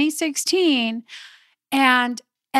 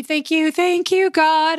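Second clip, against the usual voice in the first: relieved thanks; 4 semitones higher, 3 dB louder than usual. Retold or imitated. Relief arriving at villa.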